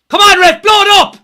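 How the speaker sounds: loud, high desperate plea; pleading with the referee. Real, not acted. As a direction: beg the ref to end it. Fan pleading for final whistle.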